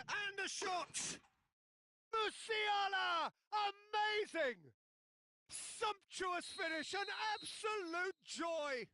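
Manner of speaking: high, slow, shouted goal call; frantic sports commentary. Acted. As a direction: scream the goal to the crowd. Goal called in match commentary.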